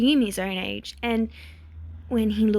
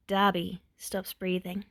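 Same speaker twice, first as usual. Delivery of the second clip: ordinary delivery, nothing marked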